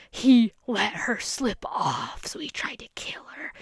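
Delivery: a hushed, slow mock-ominous whisper. Mocking the movie's villain framing, in a mock-ominous whisper. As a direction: breathe it like a spooky trailer voiceover.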